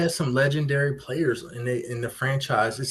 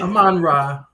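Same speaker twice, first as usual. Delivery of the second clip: "Amon-Ra" run together, louder and higher; quick pronunciation; 5 semitones higher, 6 dB louder than usual. Shown as a demonstration. Co-host showing how the name flows, quick pronunciation. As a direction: say "Amon-Ra" in one quick, punchy go. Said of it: "We just say it pretty quickly".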